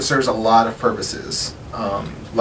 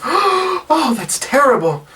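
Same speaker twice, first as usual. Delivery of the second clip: high gasping dismay; gasp, then high horrified concern; shocked aunt; 8 semitones higher than usual. Acted. Aunt hearing her nephew was captured, high shocked voice. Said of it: "she claps her hands to her face".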